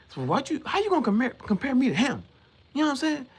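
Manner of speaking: high, offended protest; a high-pitched hammed-up protest at being compared; mock indignation. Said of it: "he actually gets kinda mad".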